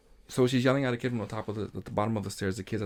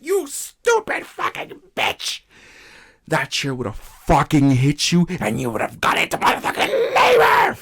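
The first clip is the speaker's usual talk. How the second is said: a worker screams at a pregnant coworker about the chair; retold scream; 2 semitones higher, 4 dB louder than usual. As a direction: rage at her through laughter.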